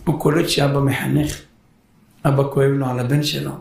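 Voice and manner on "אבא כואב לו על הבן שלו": ordinary talk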